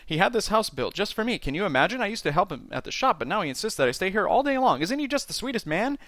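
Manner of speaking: high, gushing chatterbox hostess; effusive, hurried, swooping woman's voice; talking without breathing. A narrator's reading. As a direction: prattle on with hardly a pause. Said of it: "able to talk without make, uh, taking a breath".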